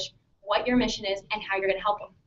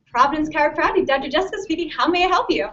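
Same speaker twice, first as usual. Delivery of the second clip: bright greeting; 4 semitones higher, 5 dB louder than usual. Shown as a demonstration. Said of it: "Or does it sound like".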